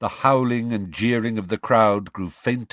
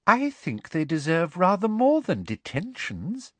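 high, sing-song suggestion; honeyed meddling; sickly sweet; 10 semitones higher than usual. Acted. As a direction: simper with a broad smile. Smiling Umbridge meddles sweetly.

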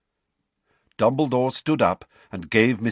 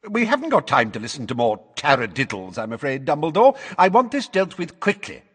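raised, pressing official voice; brusque impatience; 7 semitones higher, 4 dB louder than usual. Acted. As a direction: hurry them along, pitch climbing. Flustered official hurries the hearing.

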